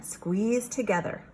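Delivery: usual speaking voice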